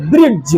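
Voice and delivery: "ब्रिज" with a hard d before j; learner's "ब्रिज", d audible; mistaken pronunciation. Shown as a demonstration. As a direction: sound the d in "ब्रिज", like "brid-j". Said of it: "क्योंकि डी से ड होता है".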